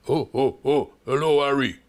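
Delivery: loud, hearty boxer catchphrase voice; Frank Bruno impression. Presenter mimicking the boxer for viewers, loud hearty impression. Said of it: "Someone trying to impersonate him".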